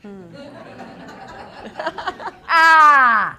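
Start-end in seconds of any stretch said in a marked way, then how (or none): 2.5-3.4 high, loud burst of delight; loud laughing shriek. Real, not acted. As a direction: let out a yelp mid laugh. Laughing outburst.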